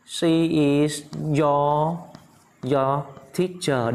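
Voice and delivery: very slow, word-by-word delivery; slow model phrase. Shown as a demonstration. Teacher builds the answer for students, very slowly. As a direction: stretch out each word for learners.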